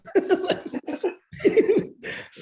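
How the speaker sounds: airy burst of laughter going up; can't hold back a laugh; high breathy laugh. Real, not acted. Laughing about the big guy getting cut, breathy laugh.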